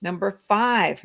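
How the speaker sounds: ordinary talk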